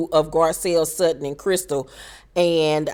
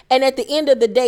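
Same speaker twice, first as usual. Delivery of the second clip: ordinary talk, nothing marked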